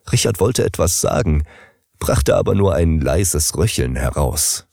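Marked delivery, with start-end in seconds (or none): none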